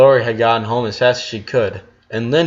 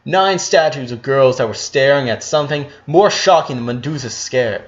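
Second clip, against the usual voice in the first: ordinary delivery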